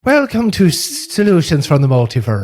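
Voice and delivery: playful Irish brogue for the intro; Irish accent. Pretend. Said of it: "it's the Irish version".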